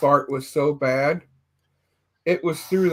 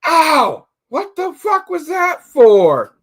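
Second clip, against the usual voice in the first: high, loud yelp of pain, slow at the end; mock outraged yelp from a slapped man; pained yell; 11 semitones higher, 7 dB louder, much slower than usual. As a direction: yelp like you just got slapped. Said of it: "you hear this guy go".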